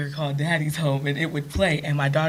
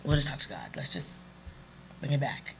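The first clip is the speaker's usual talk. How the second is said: much softer, hushed reassurance; calm husband; 3 semitones lower, 10 dB quieter than usual. Retold or imitated. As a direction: gently lower the temperature. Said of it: "he's genuinely calm".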